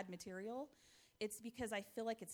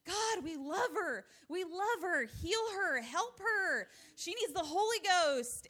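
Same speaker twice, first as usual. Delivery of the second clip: high, loud, slow, earnest pleading prayer; sincere child's prayer; 9 semitones higher, 9 dB louder, slower than usual. Retold or imitated. Mother imitates her son praying earnestly for his sister, high and slow. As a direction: pray with all your heart, slowly. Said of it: "uh, so sincerely".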